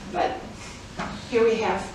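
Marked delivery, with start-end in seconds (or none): none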